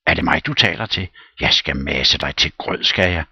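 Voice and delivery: loud, fast threatening burst; angry threat, rushed; flaring up. Acted. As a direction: bark at the servant, flying up. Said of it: "farer op".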